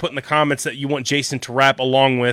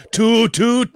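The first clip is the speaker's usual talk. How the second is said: higher, mock Jamaican accent; put-on Jamaican accent, pitched up; Shrunken Head's Jamaican accent; 8 semitones higher than usual. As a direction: imitate a movie character in Jamaican accent. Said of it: "based on the Shrunken Head".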